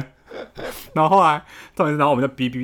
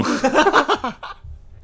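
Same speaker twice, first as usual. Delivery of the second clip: high peal of laughter after the punchline. Real, not acted. After roommate clutches his heart, high laugh. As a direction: let a high laugh spill out.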